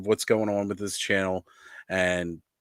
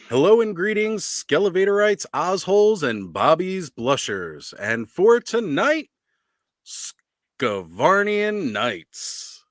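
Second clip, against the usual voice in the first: higher, louder hype announcer call; fighting game announcer; 7 semitones higher, 3 dB louder than usual. Acted. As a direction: boom out the roll call like an arena announcer. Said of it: "Gotta get that announcer voice going".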